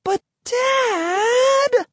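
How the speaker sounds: very high, drawn-out whine; shrieky, stretched-out wail to a parent; whining. A narrator's reading. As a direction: protest like a whiny teenager. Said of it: "Emily could whine with the best of them".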